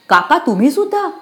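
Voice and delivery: high, loud disbelief; can't believe uncle is among them; shocked. Acted. Shocked girl sees her uncle.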